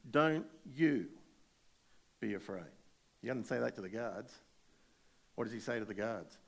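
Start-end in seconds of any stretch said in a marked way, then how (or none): 0.1-2.7 louder, punching the words Don't you; firm, emphatic reassurance from the angel. Retold or imitated. Angel firmly telling the women at the tomb, emphatic and louder. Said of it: "the angel says emphatically".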